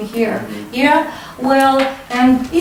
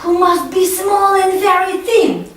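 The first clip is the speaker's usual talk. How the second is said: put-on higher, puzzled child voice ending in a laugh; wondering child; 5 semitones higher than usual. Shown as a demonstration. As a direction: act out a kid baffled by the tummy. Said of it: "Repeat after me".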